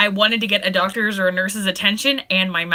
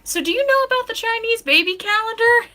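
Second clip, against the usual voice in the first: much higher, sing-out question; imitating sister; 13 semitones higher than usual. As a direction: pitch it way up as an excited caller's mock voice. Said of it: "she called me the other day and was like".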